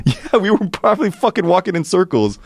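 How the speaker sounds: high, laughing confession; amused admission. Real, not acted. Storyteller admitting they walked in circles, laughing. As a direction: laugh through an absurd admission. Laughs at own absurd mistake.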